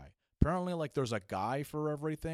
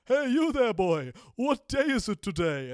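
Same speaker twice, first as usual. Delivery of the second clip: put-on much higher, much louder old miser's call; Scrooge yelling; 12 semitones higher, 10 dB louder than usual. A stranger at a party calls out to him like Scrooge. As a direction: bellow it like a reformed Scrooge at the window. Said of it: "like Ebenezer Scrooge at the end of A Christmas Carol, he, like, yells at me".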